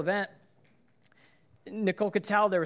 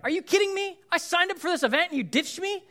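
much higher, louder mock outrage; outraged friend; 12 semitones higher, 5 dB louder than usual. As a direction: play the betrayed friend losing her mind. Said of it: "Her friend could have been like".

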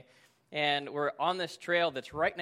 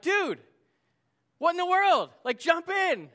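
high, loud exasperated yell; yelling across a river; 13 semitones higher, 8 dB louder than usual. Retold or imitated. Friend hollers to friend across the river, exasperated yell. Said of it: "I'm yelling at him from across the river".

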